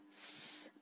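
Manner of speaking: unvoiced hushing "shh"; shushing. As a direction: hush her sharply with a breathy shh. Hand over her mouth, hushing. (श्वासातून "श्श्श" करत गप्प करणे)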